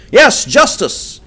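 slow, loud, high shout; echoed cry. A narrator's reading. The chieftains echo the call, shouting slowly. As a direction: shout it out as the crowd. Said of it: "echoed a dozen voices".

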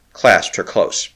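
usual speaking voice

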